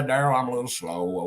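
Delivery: usual speaking voice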